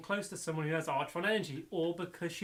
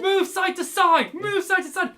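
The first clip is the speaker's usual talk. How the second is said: much louder, higher, slow barked command; shouted order; 13 semitones higher, 11 dB louder, slower than usual. Retold or imitated. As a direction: yell it like a commander under fire. Robots shouting orders in battle.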